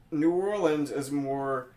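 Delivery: ordinary talk, nothing marked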